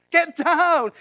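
put-on screaming a warning at the boy; loud high urgent warning cry. Shown as a demonstration. Preacher to congregation, screaming a warning. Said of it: "You'd have screamed at the top of your voice".

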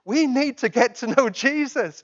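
loud, pressing call to know Jesus; loud urgent call. Real, not acted. Preacher urging the congregation to know Jesus, loud and pressing. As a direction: urge the congregation, full voice.

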